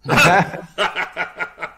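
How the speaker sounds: loud burst of laughter; real laughter. Real, not acted. Tour guide hearing the pirate letter joke, laughing. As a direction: crack up at a friend's joke.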